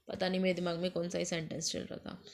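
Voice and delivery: quick, very soft self-correcting aside; embarrassed aside under the breath after a slip; muttered aside. Real, not acted. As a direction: mumble it to yourself, fast and low.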